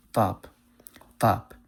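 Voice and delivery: heavy tongue-back t starting top; emphatic T. Shown as a demonstration. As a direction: top with an Arabic-style emphatic t. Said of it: "when I use an emphatic T".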